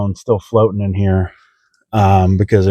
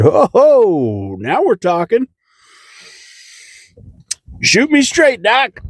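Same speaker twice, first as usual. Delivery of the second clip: much higher, gleeful mock excitement; mock eager; 12 semitones higher, slower than usual. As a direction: play it like a kid promised candy. Retold reaction to fentanyl offer.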